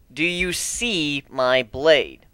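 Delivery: very slow, each word weighed; slow, deliberate repeat. Acted. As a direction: spell it out slowly to him.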